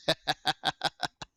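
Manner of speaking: quick, even string of ha-has; staccato laugh. Real, not acted. Host amused at being off the rails, staccato laugh. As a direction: chuckle in short separate bursts.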